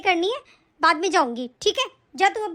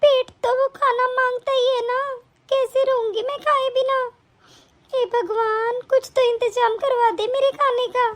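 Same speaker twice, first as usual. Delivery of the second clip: lamenting grandmother; 5 semitones higher than usual. Acted.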